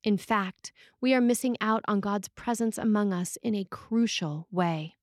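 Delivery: usual speaking voice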